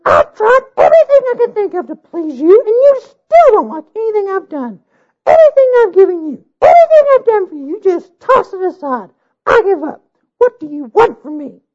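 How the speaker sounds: high, loud desperate bride; furious bride. A narrator's reading. Bride's tearful outburst at king.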